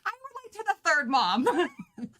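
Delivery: high-pitched, laughing admission; laughing confession. Real, not acted. Reader confessing she relates to the third mom, laughing. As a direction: own up to it through giggles.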